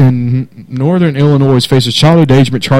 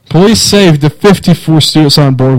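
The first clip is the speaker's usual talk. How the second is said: ordinary talk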